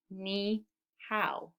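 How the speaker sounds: "Ni hao" split with a pause after Ni; syllable by syllable. Shown as a demonstration. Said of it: "I hear two syllables".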